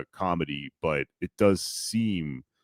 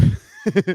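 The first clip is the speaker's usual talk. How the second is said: high shouted laugh; loud burst of laughter; 14 semitones higher, 8 dB louder than usual. Real, not acted. Laughs at own claim.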